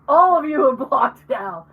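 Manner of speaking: loud, emphatic mock decree; imitating a fed-up guest; mock angry announcement. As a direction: announce it loudly to the whole room. Imagined cranky guest announces blocking.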